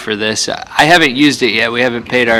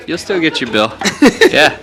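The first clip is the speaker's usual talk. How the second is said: laughing; 6 semitones higher than usual. Real, not acted. Laughing at mail question.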